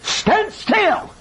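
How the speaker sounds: very high, ringing command; emphatic command. Retold or imitated. Answer to the panicking Israelites, emphatic command. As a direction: call it out to halt a panicking crowd.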